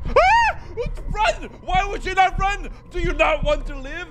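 high, loud exasperated yelling; yelling at character. Real, not acted. Player yells at their fleeing game character, exasperated. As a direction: scream at the character to run. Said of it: "I was yelling at my character".

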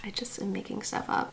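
quick whispered aside; whispering. Real, not acted. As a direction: breathe it as a private aside. Whispered aside after dictating.

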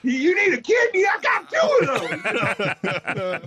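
high, hammed-up eager offer; volunteer like an overexcited kid; stretched, squeaky mock enthusiasm. Jokes as over-eager gullible kid.

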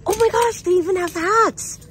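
bursting with real excitement; excited squeal. Real, not acted. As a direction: squeal over the new hats. Shopper thrilled by new items.

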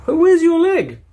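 put-on very high, loud child's question; piping child voice; kid voice. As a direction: squeak it like an insistent little kid. Kid asks loudly.

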